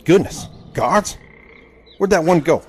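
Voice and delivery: startled by the guards, voice jumping up; alarmed. Acted.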